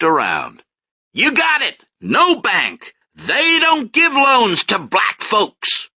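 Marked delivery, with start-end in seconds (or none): 1.1-5.9 higher, louder street-corner preaching, slow and pointed; higher, louder corner preacher naming each store; soapbox orator. Acted. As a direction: call out each shop to the crowd like a corner preacher.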